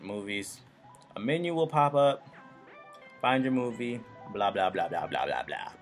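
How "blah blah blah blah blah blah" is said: ordinary talk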